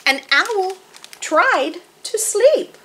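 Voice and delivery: deliberate picture-book narration with vivid animal noises; story reading to children. A narrator's reading. Narrator tells children of sleepless Owl, deliberate expressive storytelling. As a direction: read to little ones, spacing out the refrain.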